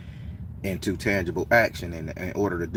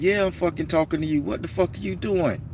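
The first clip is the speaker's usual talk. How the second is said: higher, hammed-up angry stranger; 8 semitones higher than usual. The stranger yelling over his workout bench, mock angry. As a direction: play the furious gym hog, mildly mocking. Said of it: "And he like".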